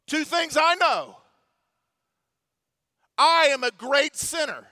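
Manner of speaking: high, forceful preacher's declaration; declaimed quote. Retold or imitated. Declaims Newton's famous quote.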